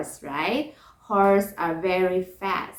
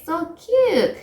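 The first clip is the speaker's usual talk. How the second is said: gushing delight; 9 semitones higher, 3 dB louder than usual. Acted. Teacher gushes over hatched chick.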